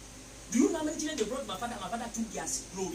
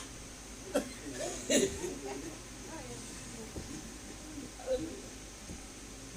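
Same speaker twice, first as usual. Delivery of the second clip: long laugh; 4 semitones higher, 5 dB quieter than usual. Real, not acted. Laughing at own story.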